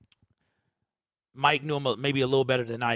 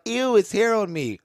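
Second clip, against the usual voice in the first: son's voice; 7 semitones higher than usual. Retold or imitated. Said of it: "My son was like".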